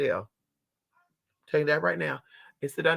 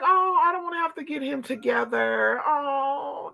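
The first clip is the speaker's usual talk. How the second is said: normal talking voice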